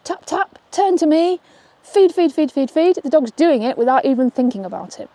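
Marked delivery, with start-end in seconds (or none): 0.0-3.0 upbeat cue. Shown as a demonstration. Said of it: "in real life".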